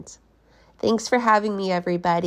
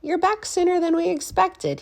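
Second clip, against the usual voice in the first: much higher, lightly voiced principal; a fussy principal caught off guard; surprised principal; 13 semitones higher, 3 dB louder than usual. Principal greets the lumpy teacher, high and surprised. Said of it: "he said".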